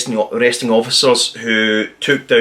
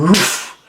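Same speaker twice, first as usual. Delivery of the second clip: woof thrown out high and quick like a splat; a sudden comic splat sound; quick comic sound effect for the gunshot; mock splatter noise; 7 semitones higher than usual. Imitating the blood splatter.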